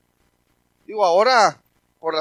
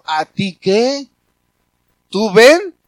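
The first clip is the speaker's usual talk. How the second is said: slow, much louder rebuke; booming voice of God; 3 semitones higher, 13 dB louder, much slower than usual. Retold or imitated. God rebuking the preacher, slow loud thunder. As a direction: thunder it down like God correcting a servant. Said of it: "Y a mí Dios me dijo eso".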